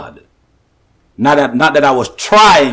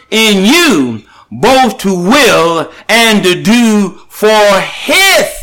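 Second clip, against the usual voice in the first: higher, drawn-out preaching cadence; preacher drives the verse home to the folks, slow and raised; slow emphatic preaching; 5 semitones higher, slower than usual. Acted. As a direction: lean on each word like a sermon climax.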